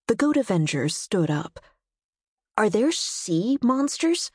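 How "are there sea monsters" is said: excited. Acted. Goat Avenger asks eagerly.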